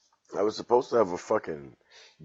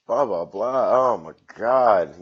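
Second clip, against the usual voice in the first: exasperated outburst; 3 semitones higher, 10 dB louder, slower than usual. Real, not acted. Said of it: "exasperated outburst".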